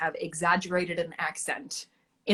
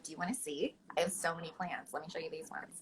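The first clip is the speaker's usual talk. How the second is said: softer, a hint of an over-eager plant lady; lightly voiced caricature, softer, almost murmuring; 3 semitones lower, 10 dB quieter than usual. Guest mimics herself offering plant photos, softer caricature voice. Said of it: "do you use that voice? 'Cause that voice is incredible".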